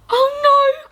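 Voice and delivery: a wail of disappointment; dismayed cry. Acted. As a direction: giant finding the shop closed, crying out. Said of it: "cried George".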